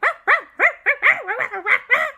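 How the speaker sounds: very high, loud yapping; barking ghost dog. Acted. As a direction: yap excitedly like a small dog.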